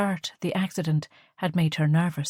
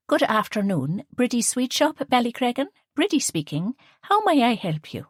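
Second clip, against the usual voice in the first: put-on higher, prim phone manner with the accent ironed out; posh telephone voice; 6 semitones higher than usual. A narrator's reading. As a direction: put on her best telephone voice for a caller. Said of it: "Liesl smiled at Bridie's telephone voice".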